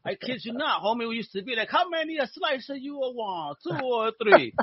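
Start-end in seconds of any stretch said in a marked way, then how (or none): 1.7-4.5 high-pitched Italian pizza shop guy; bad Italian accent. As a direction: imitate a pizza seller with a sing-song Italian accent. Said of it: "sound like homie from the pizza shop".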